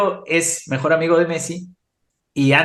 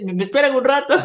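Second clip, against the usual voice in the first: put-on high little-boy plea; a small kid asking them to wait; child's voice; 11 semitones higher, faster than usual. Child on the toilet.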